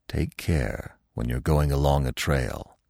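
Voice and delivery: lightly voiced old stag, low; old stag, cautioning. Old stag advising Bambi in story.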